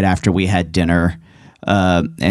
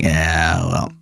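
low gravelly veteran grunt; 5 semitones lower than usual. Shown as a demonstration. Comedian demonstrating his war veteran character, low and gravelly. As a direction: drop it down into gravel. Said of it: "gravelly voice, and I was like".

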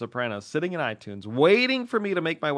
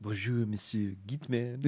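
slow, soft French greeting; a hint of a French accent; 5 dB quieter than usual. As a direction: purr it like a Parisian caller. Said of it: "the female Paris accent".

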